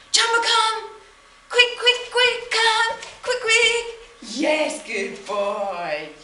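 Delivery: high, loud cheering at the dog; enthusiastic recall. Shown as a demonstration. Trainer showing owners how to call the dog, high and loud. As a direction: gush with praise like a cheerleader. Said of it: "I'll just show you one more time".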